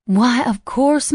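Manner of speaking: put-on high, slow, sweet Southern old lady; genteel old lady. A narrator's reading. Arlene welcoming Preppy and Doe, high and slow genteel lady. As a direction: stretch it out like a gracious grandma.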